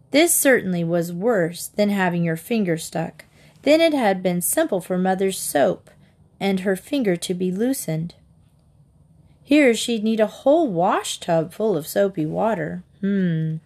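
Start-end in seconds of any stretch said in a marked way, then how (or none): none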